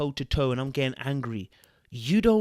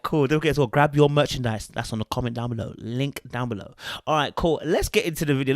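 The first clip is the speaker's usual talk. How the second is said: ordinary talk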